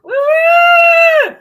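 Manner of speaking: big loud cheer for the team; cheering woo. Real, not acted. Fan whooping for her team, loud cheer.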